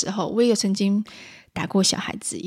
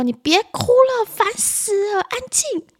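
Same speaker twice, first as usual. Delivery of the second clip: very high-pitched, fed-up scolding; irritable mother; 12 semitones higher, slower than usual. Acted. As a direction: act a mum at the end of her patience. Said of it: "暴躁的妈妈会说". (暴躁妈妈尖着嗓子骂)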